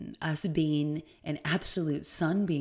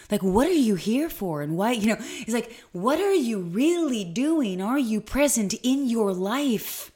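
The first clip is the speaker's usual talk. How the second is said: higher and louder, urgent and emphatic; intense Marianne Williamson; 6 semitones higher, 5 dB louder than usual. Retold or imitated. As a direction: echo her fervent challenge, pressing each question. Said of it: "she got quite intense".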